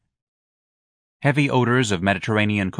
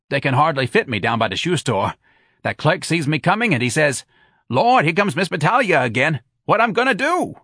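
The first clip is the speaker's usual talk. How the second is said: higher, lively woman joking on herself; brassy Santa character; 6 semitones higher, 4 dB louder than usual. A narrator's reading. Friend apologising for her big feet, animated character voice. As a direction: voice a loud, chatty friend, climbing high at the end.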